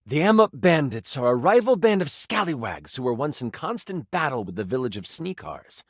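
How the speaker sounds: ordinary talk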